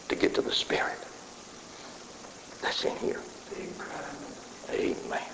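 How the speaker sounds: hushed whisper, breath only. Acted. Preacher to congregation, leaning in with a hushed whisper. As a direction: draw them in with a secret whisper.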